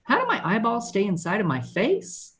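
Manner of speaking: put-on high-pitched, wide-eyed kid voice; young son's question. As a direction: imitate a curious child asking Daddy. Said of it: "first thing he said to me".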